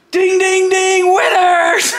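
high bell imitation into a long shout; ring announcement. Announcing the match result over the downed wrestler, imitated high call.

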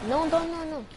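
pleading. Real, not acted. Teammate leaving, begging them stay.

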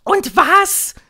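very high, loud witch shout; piercing commanding cry; calling over noise. A narrator's reading. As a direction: yell it over the din. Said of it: "rief die Hoch- und Großmeisterhexe in den Lärm".

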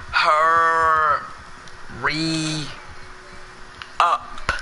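very drawn-out words with long gaps; slow. Shown as a demonstration. Player ordering the lady along, very slow. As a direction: stretch each word and pause between them. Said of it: "do I have to say it slow?".

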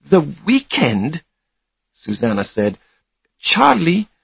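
high, drawn-out questioning echo; taken aback. A narrator's reading. Girlfriend to Charlie over cancelled weekend, taken aback.

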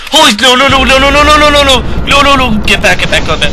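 high, loud, frantic stream of no's; panicked screaming. Real, not acted. Player screaming at the chasing monster, panicked. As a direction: scream it out in real terror.

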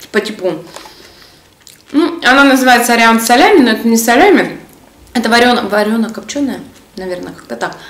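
chewing while talking; snack at the lips; mouth partly full. Real, not acted. Seen 1: holds cracker at lips, bites it around 2.6 s while speaking.

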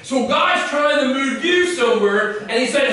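ordinary talk

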